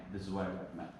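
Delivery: soft, low-conviction delivery; weak recommendation. Shown as a demonstration. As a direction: let the recommendation drop away quietly. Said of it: "without saying, again".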